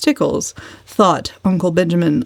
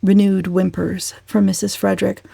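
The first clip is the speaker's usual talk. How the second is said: plain reading voice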